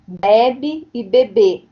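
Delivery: "bebe" with open first vowel, then "bebê" with stress on the final closed ê; stress contrast. Shown as a demonstration. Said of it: "a gente tem ali bebe e bebê".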